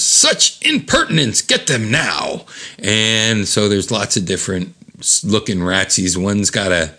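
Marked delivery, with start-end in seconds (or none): 0.0-2.4 high outrage dropping into a drawn-out order; indignant, stretched-out villain order; Omega Man. Acted. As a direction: command like an offended comic villain. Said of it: "Omega Man, close up, says".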